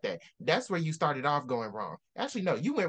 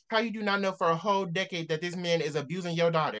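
ordinary talk, nothing marked

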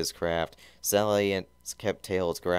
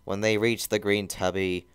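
ordinary delivery

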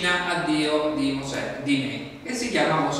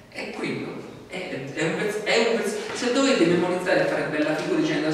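normal talking voice

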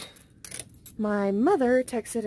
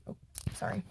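sheepish whisper; 8 dB quieter, faster than usual. Real, not acted. Apologising for swearing.

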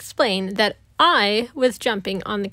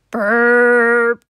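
a big rude belch; cartoon belch, full force; loud burp; 7 dB louder than usual. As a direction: let out a huge, loud belch. Loud burp after soda.

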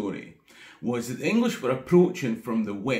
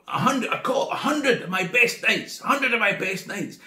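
raised, commanding king's call; half-shouted order of a furious king; royal call out; 6 semitones higher than usual. Retold or imitated. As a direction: summon your knights in a rage. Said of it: "he calls out".

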